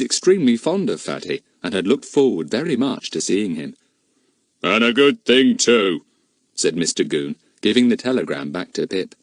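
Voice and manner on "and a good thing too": gloating policeman. A narrator's reading. Goon gloats.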